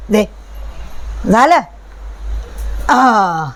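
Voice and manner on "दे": normal talking voice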